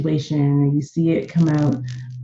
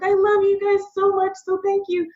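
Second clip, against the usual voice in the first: put-on high, gushing little boy voice; imitating her crying son. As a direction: pitch way up, overwhelmed young kid thanking. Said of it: "He was like".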